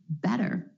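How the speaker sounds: "Better" with the T flapped like a quick D. Shown as a demonstration. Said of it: "The flap T is really just a D sound".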